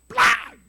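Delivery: chasquido imitado, súbito, fuerte y agudo; onomatopeya de golpe. Acted. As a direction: imita un cintazo con la voz. Imita el golpe del cinto. (golpe seco imitado)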